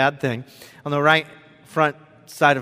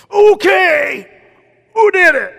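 put-on loud high shout at the kids; imitating a furious dad shout; mock angry shout; 12 dB louder, slower than usual. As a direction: yell it out, accusing. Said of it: "could have got out of my car and gone in and just".

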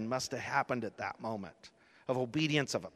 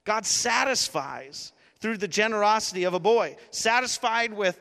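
raised, louder preaching push; preaching climax; 7 semitones higher, 5 dB louder than usual. Acted. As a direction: build the sermon to its peak. Preaching climax at full force.

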